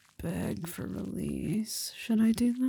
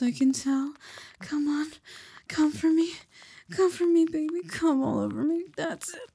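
building excitement; 2 semitones higher, 5 dB louder than usual. Acted. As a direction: climb in pitch and volume, pushing her on. Building to a climax in the scene.

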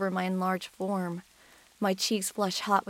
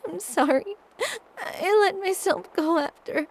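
high, tearful apology breaking; crying, choking out sorry; 11 semitones higher, 4 dB louder than usual. Acted. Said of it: "I sniffle".

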